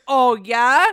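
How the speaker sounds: loud rising challenge; loud mock challenge. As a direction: dare them, loud and high. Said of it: "She's like".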